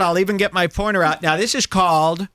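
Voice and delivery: ordinary delivery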